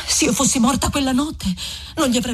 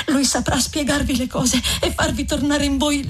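Lucia pleading; 3 semitones higher than usual. Acted. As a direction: beg him gently with a pitched-up voice. Lucia begs Renzo to leave.

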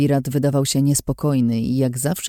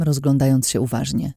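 ordinary delivery, nothing marked

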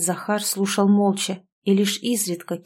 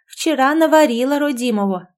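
higher, louder happy cry; louder beaming exclamation; joyful exclamation; 6 semitones higher, 5 dB louder than usual. A narrator's reading. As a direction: exclaim with delight. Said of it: "радостно воскликнула хозяйка".

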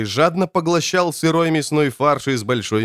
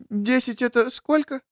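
put-on high, curious child's question; eager little child; 10 semitones higher, 4 dB quieter than usual. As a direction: perk up like an interested kid. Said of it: "живо заинтересовался".

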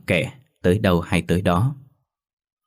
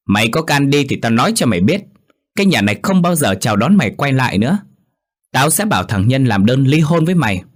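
high, loud shouting at the daughter-in-law; angry scolding; 6 semitones higher, 4 dB louder than usual. A narrator's reading. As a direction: yell her out of the house. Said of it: "bà Cúc chỉ chết". (mẹ chồng quát con dâu giận dữ)

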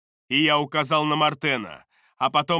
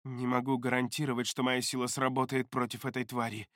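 trembling voice; 8 dB quieter than usual. A narrator's reading. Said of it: "дрожащим голосом сказал он".

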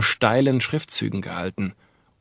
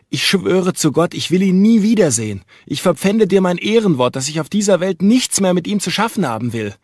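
higher, louder desperate oath; crying out; 8 semitones higher, 4 dB louder than usual. A narrator's reading. Jekyll swears to Utterson he is done with Hyde, loud. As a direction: swear it out loud, pleading to be believed. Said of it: "rief der Doktor".

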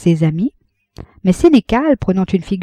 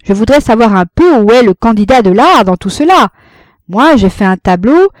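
haughty; 4 semitones higher, 6 dB louder than usual. A narrator's reading. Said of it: "le prit de haut avec la foule".

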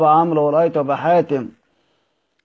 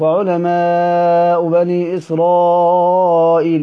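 slow, drawn-out tajweed chant; Quranic recitation; much slower than usual. Shown as a demonstration. Teacher quoting a verse to students, slow recited chant. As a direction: stretch each syllable as in tarteel. Quran words quoted in lesson.

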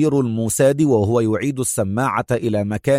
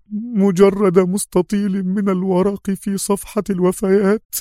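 mournful lament; 7 semitones higher than usual. A narrator's reading. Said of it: "ابتسمت في رثاء".

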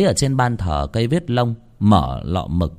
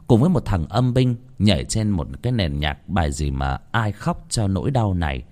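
ordinary delivery

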